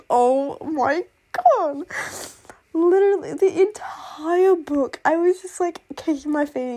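high, loud, drawn-out gushing; fangirl squeal. Real, not acted. Fan gushing over her favourite villain romance, excited squeal. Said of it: "just screaming at the top of my lungs".

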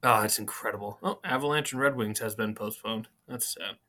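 usual speaking voice

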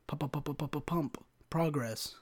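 soft rapid "Pump, pump, pump" with popped P's; popping P's. Shown as a demonstration. Comic testing the P's in "pump, progress", softly popped. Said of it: "It's a lot of P's right there".

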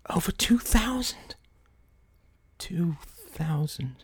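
slow whispered figure; hushed whisper. Acted. Larry and Kay whisper the shocking sum.

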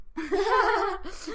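high, loud burst of laughter; bright burst of real laughter. Real, not acted. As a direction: laugh out loud at your own joke. Laughing after sponsor joke.

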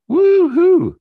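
high, loud joyful woo-hoo; excited whoop. Real, not acted. Spiritual teacher whooping to his online group, gleefully loud.

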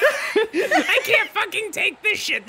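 laughing anguish. Acted. Character breaks down in guilt.

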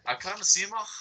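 high-pitched imitation Scouse; Scouse accent. Shown as a demonstration. Guest repeats the practice phrase, high Scouse accent. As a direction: copy the Scouse phrase, "mach" scraped in the throat. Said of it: "if you wanna do a good Scouser accent".

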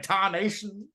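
put-on playful Southern-accented cursing. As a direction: toss out a Southern American insult. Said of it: "They're all, like, Southern insults".

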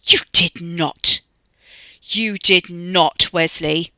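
loud, drawn-out outrage; indignant, slow and forceful; indignant disbelief. A narrator's reading. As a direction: stretch each word in appalled accusation. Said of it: "Tina gave an indignant gasp".

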